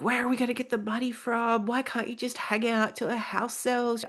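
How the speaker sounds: wife imitating her husband's protest; mock husband in a fight.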